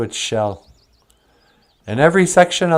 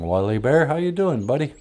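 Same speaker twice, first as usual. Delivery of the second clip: ordinary talk, nothing marked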